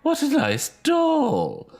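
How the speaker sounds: high, slow, loud entranced voice; drawn in by the doll, strangely; eerie delighted lady. Acted. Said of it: "An odd expression came over".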